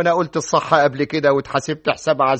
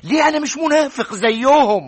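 anguished outcry; 10 semitones higher, 5 dB louder than usual. Acted. Clerk's despairing outburst.

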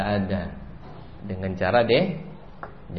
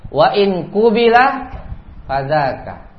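hadith recitation; 8 semitones higher, 6 dB louder, slower than usual. Acted. Quoting Rasul in Arabic.

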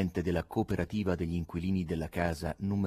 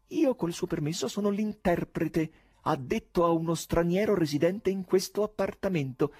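cordial exclamation; 10 semitones higher than usual. A narrator's reading. Korofiev fobs off the chairman, high cordial voice with chuckles. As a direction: gush over him like a slippery charmer. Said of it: "si presentò".

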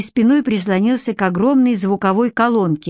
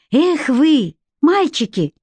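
higher, like a kindergarten teacher; reproachful; 6 semitones higher, slower than usual. Acted. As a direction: scold gently as if to toddlers. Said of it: "укоризненно сказала Зоя, как говорят малышам воспитатели".

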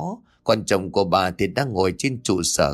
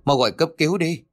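urgent urging; 7 semitones higher, faster than usual. A narrator's reading. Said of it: "Tiếng của ông hai thúc giục".

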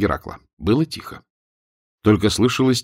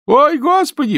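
высокое громкое причитание; запричитай тонко и громко; причитая; 5 dB louder than usual. A narrator's reading. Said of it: "причитала Нюра". (бабье причитание)

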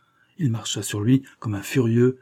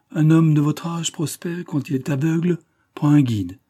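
higher-pitched gentle mockery; half mocking, half pitying; 6 semitones higher, 4 dB louder than usual. A narrator's reading. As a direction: tease him with a touch of pity. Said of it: "Le ton de monsieur Verduret, moitié de raillerie, moitié de commisération". (mi-railleur, mi-compatissant)

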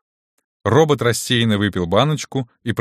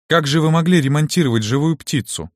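put-on surprised girl; 5 semitones higher than usual. A narrator's reading. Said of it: "удивилась Алиса".

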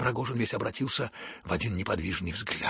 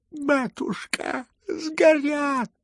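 very high, drawn-out wail; loud high-pitched lament; 6 dB louder, much slower than usual. A narrator's reading. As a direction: shriek it slowly in a thin, pleading voice. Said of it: "вопил Лебедев".

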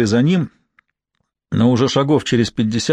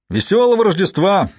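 high, forced-cheery greeting; strained cheerfulness; 8 semitones higher than usual. A narrator's reading. Traveller greets silent masked riders again, with forced cheer. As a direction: repeat the holiday greeting with laboured brightness. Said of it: "повторил Барт с натужной бодростью".

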